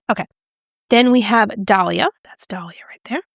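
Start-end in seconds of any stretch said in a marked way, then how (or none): 2.2-3.2 hushed whisper over the swatch; excited product whisper. Real, not acted. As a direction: breathe it out in a whisper. Whispered beauty swatch, unvoiced.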